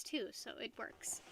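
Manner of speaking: breathy whispered aside; little conspiratorial whisper. Acted. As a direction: drop to a whisper for the punchline. Tiger beard joke aside.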